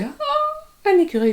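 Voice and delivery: high gasped "ah" of wonder; acted delight. Woman showing friend how a squirrel stops her world, excited gasp. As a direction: act thrilled at a sudden sighting.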